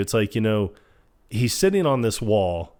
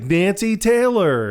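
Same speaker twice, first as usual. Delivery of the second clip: high, slow, drawn-out name call; mock-delighted greeting, pitched up; excited greeting; 9 semitones higher, 4 dB louder, slower than usual. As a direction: imitate gushing recognition of an old friend. Imitates Phil greeting Nancy excitedly.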